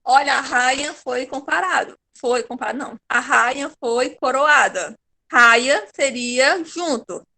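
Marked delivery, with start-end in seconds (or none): 5.3-7.2 slow and louder, Raia drawn out; teacher demonstration; slow demo. Shown as a demonstration. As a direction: spell out the sound slowly. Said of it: "Mas como eu não falo raia".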